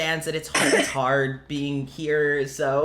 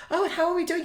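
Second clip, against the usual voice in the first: [name]; 12 semitones higher than usual. Acted. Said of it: "you realize that he's, like, now so focused on you guys".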